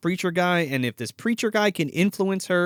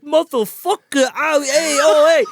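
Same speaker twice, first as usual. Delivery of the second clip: high, loud cartoon Italian wiseguy; mock Italian accent; 9 semitones higher, 6 dB louder than usual. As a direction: draw out the curse in a hammed-up Italian accent. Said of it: "an Italian guy".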